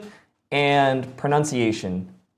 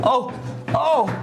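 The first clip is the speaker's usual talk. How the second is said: high-pitched startled cries; alarmed yelping; 10 semitones higher than usual. Acted. Streamer suddenly under attack on stream, alarmed yelps.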